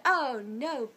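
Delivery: mock indignant outburst; retold cussing out. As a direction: imitate an indignant woman cussing. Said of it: "she started cussing us out".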